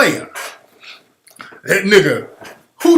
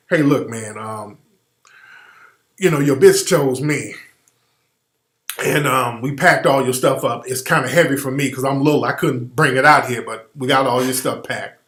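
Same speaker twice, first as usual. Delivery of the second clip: lightly voiced little smooth talker, lower and softer; a hint of a small, calm pimp breaking the news; Emmanuel Lewis voice; 6 semitones lower, 4 dB quieter than usual. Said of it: "And Emmanuel Lewis gotta come pimp serve your ass".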